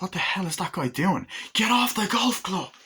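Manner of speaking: mock yell. Angry man yelling at golfers.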